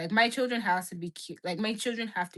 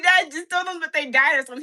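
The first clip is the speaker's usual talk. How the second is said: high, loud plea half laughing half crying; 7 semitones higher, 7 dB louder than usual. Retold or imitated. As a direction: beg through tears of laughter. Begging dad while crying laughing.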